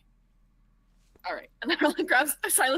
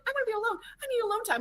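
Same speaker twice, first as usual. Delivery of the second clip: high mimicking voice; 9 semitones higher, faster than usual. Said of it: "She was like".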